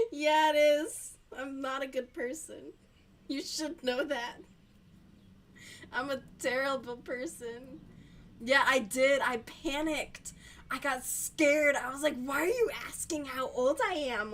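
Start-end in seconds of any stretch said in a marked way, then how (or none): 0.1-1.1 loud, higher, drawn-out agreement; emphatic admission. Real, not acted. Admitting to viewers that it's mean, loud drawn-out agreement. Mock-owning being mean. 12.2-14.3 higher, louder flustered protest; mock panic. Agent panicked by caller asking age, mock-flustered protest.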